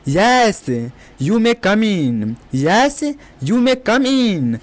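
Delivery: slow, careful English; slow teacher demo; slow model sentence. Shown as a demonstration. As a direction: say it slowly for learners to repeat. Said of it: "इस type से बोलना है।".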